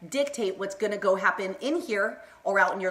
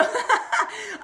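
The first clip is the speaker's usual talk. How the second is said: high-pitched burst of laughter; real high laugh; 11 semitones higher than usual. Real, not acted. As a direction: laugh out high mid-sentence. Laughs at herself while streaming.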